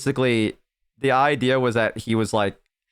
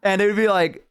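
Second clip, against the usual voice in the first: ordinary talk, nothing marked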